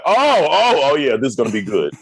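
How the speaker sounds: hyped-up onlooker; eager reaction. Acted. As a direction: react like an audience spotting a good joke. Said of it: "They'll be like".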